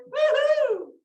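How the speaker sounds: high, loud cowboy whoop; Texas whoop. Real, not acted. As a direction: holler it out like at a rodeo. Texan cheer to the crowd.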